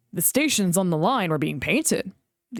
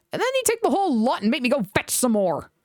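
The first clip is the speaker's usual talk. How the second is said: cross painter; 6 semitones higher, faster than usual. A narrator's reading. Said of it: "stumped crossly away".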